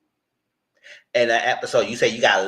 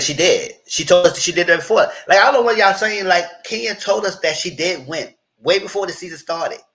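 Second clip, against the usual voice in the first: loud, higher, insistent defense; heated retort; 8 semitones higher, 7 dB louder than usual. Retold or imitated. Loud, defending Kenya to the cast. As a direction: push back hard and loud.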